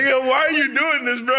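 high, pleading whine; mock embarrassed whine. Younger brother begging his sister to stop showing his bruise, high whine. Acting himself embarrassed as a kid.

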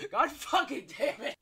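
high, loud curse through real laughter; helpless laughing curse. Real, not acted. Actor ruining the serious scene, helpless laughing curse. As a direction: shriek it out while cracking up.

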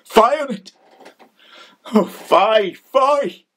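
high-pitched cry of revulsion; disgusted outburst. Acted. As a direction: burst out in disgust at the world.

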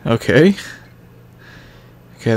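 ordinary talk

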